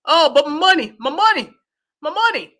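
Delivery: high, loud mocking wail; hammed-up high-pitched lament; mock money-worrier. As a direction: cry out for the money in mockery. Mocks worried travel industry voices.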